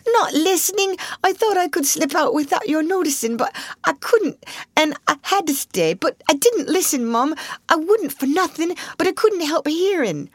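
put-on high, pleading servant girl; protesting maid. A narrator's reading. Maid denying she eavesdropped, high and pleading. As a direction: protest it quickly. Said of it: "Becky protested".

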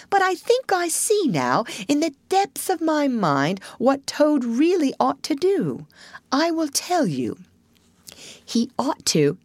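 high-pitched, deliberate Rat; ponderous Rat. Acted. Rat reveals what Toad should do, self-importantly.

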